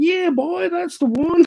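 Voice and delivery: put-on very high, whooping Southern boy; excited put-on Southern accent; loud Southern US impression, pitched right up. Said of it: "pure Southern boy accent".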